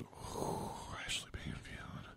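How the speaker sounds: hushed whisper, no voice. Real, not acted. Whispers the anchor's name to the audience as an aside. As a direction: breathe the name in a secret whisper.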